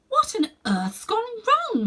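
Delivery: high, a touch slow and scared; a girl only a little frightened; mildly frightened. A narrator's reading. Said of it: "said Polly in a frightened voice".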